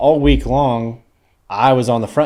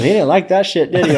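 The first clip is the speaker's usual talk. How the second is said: teasing teammate; 8 semitones higher, 4 dB louder than usual. Retold or imitated.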